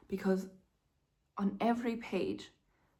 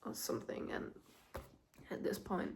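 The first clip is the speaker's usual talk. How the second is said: tired whisper; 10 dB quieter, faster than usual. Real, not acted. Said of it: "I'm tired".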